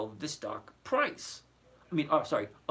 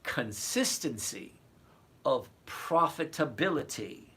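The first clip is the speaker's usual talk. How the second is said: slow, spaced-out CONSISTENCY, pause, OF PROFITABILITY; slow repeat. Real, not acted. Trader repeats his point to an inattentive follower, slowly. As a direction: spell it out slowly for someone not listening. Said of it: "say this again slowly".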